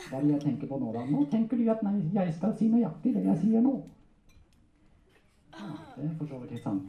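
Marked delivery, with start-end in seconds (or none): 1.1-3.7 high, cheeky ventriloquist puppet voice; puppet character. Acted. Puppet correcting its handler, high comic voice. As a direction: pipe up higher, like a comic puppet. Puppet figure claims mind reading.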